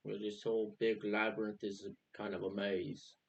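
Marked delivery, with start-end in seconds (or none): none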